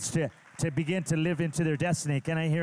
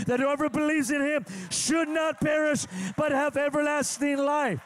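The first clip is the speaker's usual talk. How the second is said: God's voice in preaching; 9 semitones higher than usual. Acted. Voices God's words while preaching.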